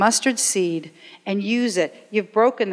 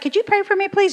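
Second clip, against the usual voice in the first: imitating students; 11 semitones higher, faster than usual. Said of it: "It's like".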